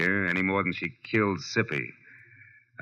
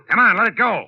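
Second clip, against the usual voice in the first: firm command; 13 semitones higher, 8 dB louder than usual. Acted. Marlowe ordering gun dropped.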